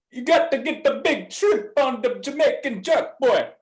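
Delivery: a light Jamaican lilt; Jamaican accent. Pretend. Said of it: "Phil, do you care to".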